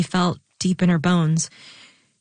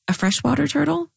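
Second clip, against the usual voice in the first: surprised question; 9 semitones higher than usual. Acted.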